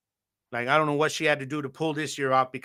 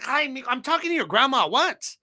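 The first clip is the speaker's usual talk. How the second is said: high, breezy mom voice playing it off; mom brushing him off; 10 semitones higher than usual. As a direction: imitate mom playing innocent, pitched up. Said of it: "She's like".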